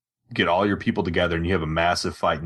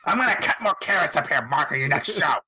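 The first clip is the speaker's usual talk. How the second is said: much higher wife voice, threatening; mock angry wife; 9 semitones higher than usual. Wife threatening Mark after finding the bill, high mock threat. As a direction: threaten him as the scolding wife would.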